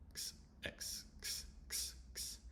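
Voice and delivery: unvoiced "X" as a bare "ks"; X sound. Shown as a demonstration. Teacher isolating the X sound for learners, unvoiced ks. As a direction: repeat "X" as a whispery k-s burst. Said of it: "It's just the X sound".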